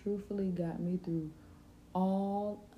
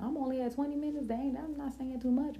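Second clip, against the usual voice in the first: high, fast worried self-quote; high-pitched hurried fretting; fretting about the time; 7 semitones higher than usual. Retold or imitated. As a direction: rush it out like you're panicking about the clock. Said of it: "I used to be like".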